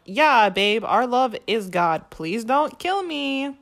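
loud, slow scared pleading; hammed-up terrified placating; mock frightened appeasing. Veronica humours J D out of fear, mock scared. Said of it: "So she's kinda like".

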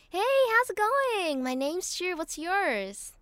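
high, loud, peppy American accent. Shown as a demonstration. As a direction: try out an American greeting, pitched high. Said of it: "Can you do an American accent?".

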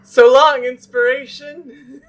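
wailed goodbye; hammed-up sobbing cry; mock tearful farewell.

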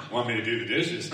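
a coy offer broken by laughter; laughing mock offer. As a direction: laugh through it, playing innocent. Mocking himself, laughing.

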